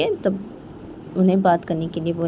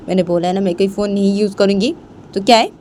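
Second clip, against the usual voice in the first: louder annoyed retort, rising at the end; irritated refusal; 3 semitones higher, 5 dB louder than usual. Acted. Rohan turns down Rohit's new phone, irritated. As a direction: snap back with building volume.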